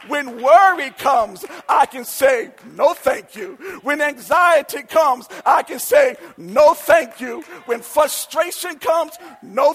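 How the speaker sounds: rousing call-and-refusal preacher cadence; full-force preaching. Real, not acted. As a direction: preach it to the congregation, building to a shouted climax.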